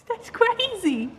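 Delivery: high, slow, laughing "that's crazy"; laughing disbelief. Real, not acted. Overwhelmed by the news, laughing up high.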